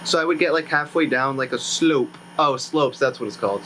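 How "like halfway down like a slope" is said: ordinary talk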